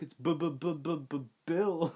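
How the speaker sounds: exaggerated stutter on the B of Bill, drawn out; mocking stutter. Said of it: "he just starts making fun of everyone".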